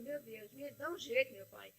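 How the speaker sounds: whispered prayer beside the sleeping husband; hushed prayer. Retold or imitated. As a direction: pray under your breath so he won't wake.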